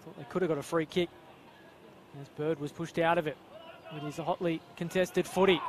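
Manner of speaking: normal talking voice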